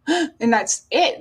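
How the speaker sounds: slow, gasping and crying as the words break; a real catch of breath, then the words drag out; choked gasp. Real, not acted. Reliving her son's last breath, crying with a gasp.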